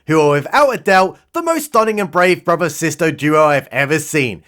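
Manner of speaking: normal talking voice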